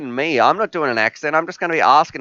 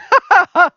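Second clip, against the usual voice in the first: very high, loud cackle of laughter; real high-pitched laugh; 7 dB louder than usual. Real, not acted. Laughs at donation threat.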